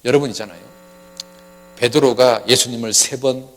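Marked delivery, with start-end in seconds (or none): none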